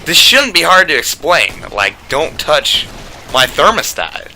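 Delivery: mock-patient lecture that drags out the last words; exasperated scolding. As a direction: lay down the law like he's slow. Ranting at roommate's friend.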